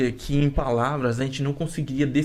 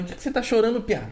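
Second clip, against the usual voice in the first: mother's worried question; 7 semitones higher, faster than usual. Retold or imitated. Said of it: "Não é piá, né?".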